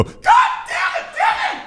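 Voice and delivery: shrieking very high, breaking into laughter; a sweet teacher who finally snaps and screams; frazzled teacher yelling. Retold or imitated. Comic mimicking the kind teacher losing it with Devin, shrieking high. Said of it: "a kind-hearted woman would go".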